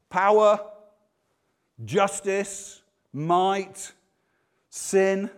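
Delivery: loud, high, isolated words; forceful listing. Acted. Mock list of assumed divine traits.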